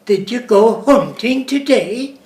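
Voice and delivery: mock Swedish lilt, stretched out; drawn-out Swedish accent. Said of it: "They have a rhythm".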